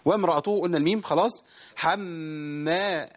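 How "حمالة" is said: held two-count m in "حمالة"; doubled meem. Shown as a demonstration. Teacher demonstrating the doubled meem, slow "حمالة". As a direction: say "حمالة" slowly, humming the doubled م before opening. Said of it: "الميم مشددة".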